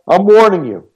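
scared homeowner yelling a threat. A narrator's reading. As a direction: order her off the porch. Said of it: "he yelled".

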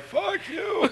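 very high, strained squeak; hammed-up strangled whine; feeble pained curse. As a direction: squeeze out a curse through mock agony. Feeble curse through pain.

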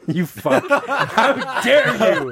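high, slow, laughing; laughing mock outrage. Real, not acted. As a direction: fake offense while cracking up.

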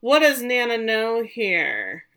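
loud, drawn-out question; incredulous. Real, not acted. Astonished reaction to a plot twist.

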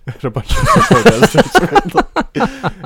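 high, loud, laughing through the words. Real, not acted. Retelling a comic's absurd firing to co-host, laughing. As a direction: crack up while finishing the line.

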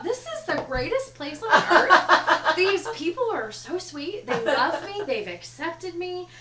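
naive delight. Retold or imitated. Northerner newly in Kentucky, gushing with naive delight. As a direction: gush like a newcomer charmed by everyone.

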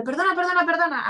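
high, pleading sorry; pleading apology. Retold or imitated. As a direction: beg forgiveness of the newborn. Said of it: "yo solo podía decirle". (disculpa suplicante, aguda)

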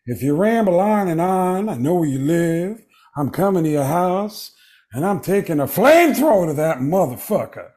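high, sing-songy Pacino voice; Al Pacino impression. Al Pacino voicemail for [name], sing-songy Pacino. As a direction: menace them with Pacino's sing-song swagger. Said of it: "Al Pacino's Scent of a Woman".

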